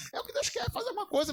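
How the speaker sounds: high, laughing through the words. Real, not acted. Pastor laughing warmly about the woman selling water. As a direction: chuckle while praising her effort.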